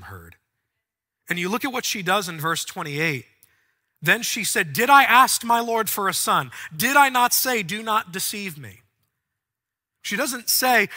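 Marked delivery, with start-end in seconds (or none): 4.7-8.8 accusing. Acted. Mother accuses the prophet.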